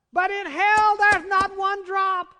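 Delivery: loud and high, slow on not one drop; thundering preaching. Real, not acted. Preacher shouting to his congregation about hell's thirst. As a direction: bellow it from the pulpit. Preacher hammers home point.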